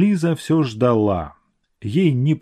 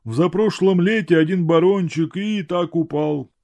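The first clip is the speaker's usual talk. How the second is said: put-on old watchman voice; 7 semitones higher than usual. A narrator's reading. Watchman tells of the baron.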